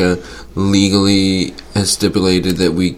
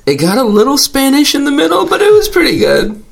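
squeaky high voice; high-pitched. Real, not acted. Bragging about accent attempt.

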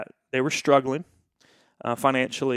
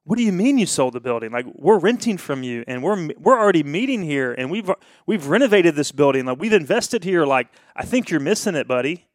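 loud, exasperated protest; frustrated complaint; 4 semitones higher, 7 dB louder than usual. Retold or imitated. Pastor upset on the phone to the selling pastor, loud and frustrated. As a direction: vent the upset, louder and a bit higher. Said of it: "so frustrated, so upset".

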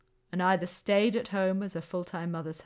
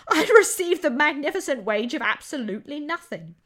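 higher, louder sarcastic scorn; disgusted sarcasm; 8 semitones higher, 9 dB louder than usual. Retold or imitated. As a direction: sneer at the magnificent wage. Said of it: "recalled in disgust".

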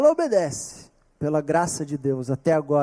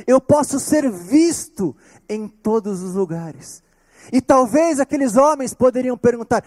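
loud, raised preaching voice; forceful preaching; 5 semitones higher, 4 dB louder than usual. Acted. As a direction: preach it with full conviction. Pregação em voz forte. (pregação inflamada)

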